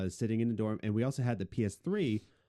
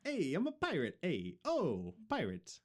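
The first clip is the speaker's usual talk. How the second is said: pirate voice; 5 semitones higher, 5 dB quieter than usual. Pretend. Joking pirate voice in dorm.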